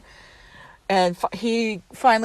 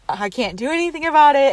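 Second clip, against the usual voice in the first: quick, higher, louder retold sass; sassy; 6 semitones higher, 5 dB louder, faster than usual. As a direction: brush off a passenger with mock sass. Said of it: "gives the best sass ever".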